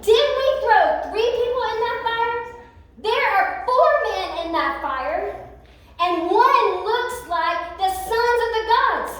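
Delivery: a king who can't believe his eyes; astonished king. Acted. King calling to his men about the fourth man, astonished.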